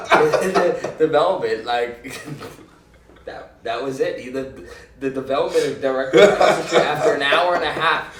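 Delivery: words bursting out between laughs; laughing hard at the punchline. Real, not acted. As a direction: talk through loud laughter.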